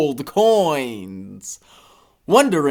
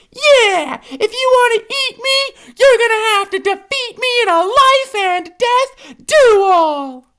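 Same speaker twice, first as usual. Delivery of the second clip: high, louder defiant hippo voice; hippo's challenge; 5 dB louder than usual. A narrator's reading. Hippo to dragon, high defiant challenge. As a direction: dare the dragon to a duel. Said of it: "the hippopotamus says".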